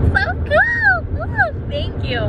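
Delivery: very high squeal of delight; excited squeal. Real, not acted. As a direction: squeal it out, thrilled. Squealing thanks for gifts.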